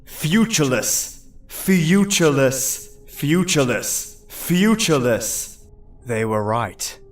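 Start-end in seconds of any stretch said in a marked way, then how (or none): 0.0-5.7 mocking chant of "Futureless!"; taunting. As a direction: hurl "Futureless!" at him like a jeering chorus. Voices taunting him.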